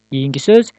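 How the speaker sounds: ordinary talk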